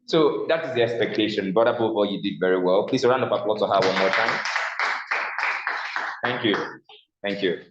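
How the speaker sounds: normal talking voice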